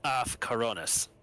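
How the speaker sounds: normal talking voice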